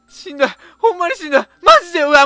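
ordinary delivery

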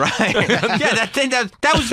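high, laughing confession; real laughter, voice jumping high. Real, not acted. Owning up, laughing.

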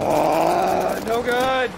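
loud high cry of dismay; real high-pitched shout; alarmed yell. Real, not acted. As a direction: yell out high as it all goes wrong. Cries out as the fight goes bad.

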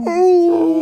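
high, loud, lonely dog howl; imitated sad howl. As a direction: howl like a lone dog at the window. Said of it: "then it sounds so sad".

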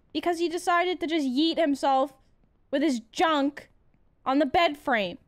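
ordinary talk, nothing marked

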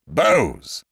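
a captain cutting her off with a thundered order; thundering. A narrator's reading. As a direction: trumpet it across the deck. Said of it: "the voice of Ned Lowe thundered out like a trumpet".

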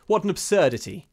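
high, loud, lightly dismissive; easy dismissal. A narrator's reading. Henry suddenly dismissing the shadow, easily. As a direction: laugh it off with ease. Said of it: "he said easily".

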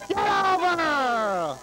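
high, slow drawn-out yell; will the ball over at full voice; shouting at the ball. Real, not acted.

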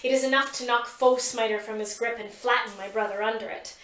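plain reading voice

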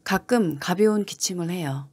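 normal talking voice

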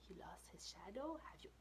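whispered surprised question; hushed disbelief. Real, not acted. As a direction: whisper it with wonder.

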